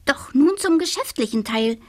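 the witch turning brisk; businesslike little witch. Acted. (geschäftige kleine Hexe, höhere Stimme)

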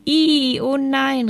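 high, drawn-out squeal of disgust; crying out. A narrator's reading. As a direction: cry out in dismay at a stink. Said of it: "riefen nun auch die anderen".

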